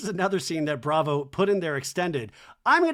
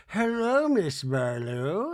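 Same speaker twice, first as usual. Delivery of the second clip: Meredith; much slower than usual. Retold or imitated. Meredith greeting Heather dressed as Lisa, slow singsong mimicry. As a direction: stretch the greeting in a playful Lisa send-up. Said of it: "dressed like Lisa and says to Heather".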